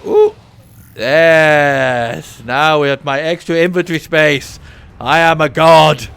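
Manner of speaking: loud gleeful boast; triumphant. Real, not acted. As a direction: crow with delight. Triumph at inventory.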